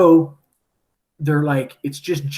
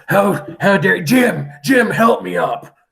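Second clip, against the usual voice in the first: weak pirate calling out; 5 semitones higher, 4 dB louder, slower than usual. Retold or imitated. Weak pirate wakes, calls Jim.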